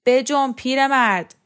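exasperated yell; impatient shout. A narrator's reading. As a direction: shout to hurry someone along. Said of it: "طاقت از کف داد و فریاد کشید".

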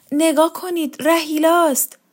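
high, urgent announcement; startled exclamation. A narrator's reading. Pale woman to the household, startled excited cry.

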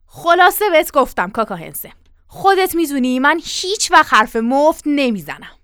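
firm, irate ultimatum; stern warning. Acted. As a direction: scold as if stamping your foot. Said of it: "پاشو به زمین کوبید و گفت".

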